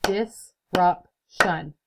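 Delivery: slow, beat by beat; clapped out by syllable. Shown as a demonstration. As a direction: clap out every syllable. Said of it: "let's clap this out".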